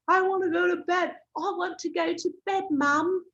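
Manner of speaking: put-on high-pitched, whiny British kid voice; mock-whine like a tired little British boy; British child accent. Tired child complains to Mum at bedtime, whiny British accent. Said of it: "that's a brilliant British accent".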